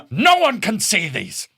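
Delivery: slow, high-pitched theatrical wail; mock horrified cry. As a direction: draw out each word in mock outrage. Acted horror at embarrassing videos.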